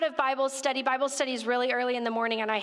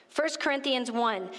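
ordinary talk

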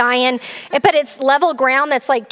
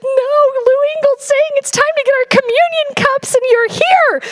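high, strained, near-tearful wail; distraught crying reply; 13 semitones higher than usual. Retold or imitated. Telling Sasha she is missing communion, high and tearful. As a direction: blurt it out as if breaking down in tears.

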